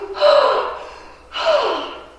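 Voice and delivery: loud high gasp after running; breathless gasp. Acted. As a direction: gulp for air, spent. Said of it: "and breathing".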